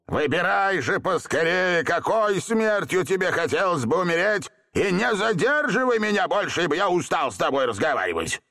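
high-pitched, domineering genie demand; impatient threat. A narrator's reading. Genie demanding Seryozha choose his death, impatient. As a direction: order him to hurry up.

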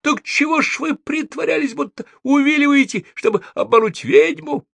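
very high, accusing squeal. A narrator's reading. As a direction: squeal it out at the top of your range. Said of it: "завизжала Софи". (пронзительно визжать)

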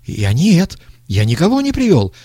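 panicked babbling. A narrator's reading. Young man with trembling lips denies it, babbling. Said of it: "лепетал молодой".

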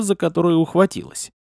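ordinary delivery, nothing marked

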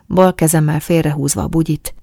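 plain reading voice

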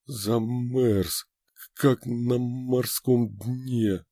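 very slow, shivering; teeth chattering. A narrator's reading. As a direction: chatter the words out. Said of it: "Отбивая зубами чечётку".

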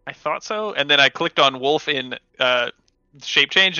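normal talking voice

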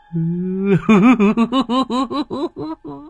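high, wild crazed laughter; mad laugh. Retold or imitated. Mãnh bursts into crazed laughter before the villagers. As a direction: cackle like a madman. Madman laughs after raving.

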